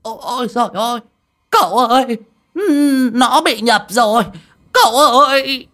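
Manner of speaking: very high, wailing cries of horror; shrieking old medium in a panic; terrified howl. A narrator's reading. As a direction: howl for help in terror. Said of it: "Ông Đồng liền hú lên kinh hãi".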